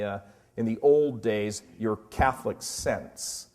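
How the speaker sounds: ordinary delivery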